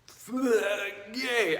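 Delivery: cracked, sudden emotional release; bursting confession. Retold or imitated. Blurts out confession.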